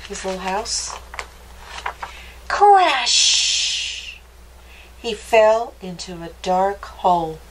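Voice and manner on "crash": high, drawn-out crash with a long sh; crash sound effect. A narrator's reading. Narrator voices the fall into a dark hole, high dramatic crash.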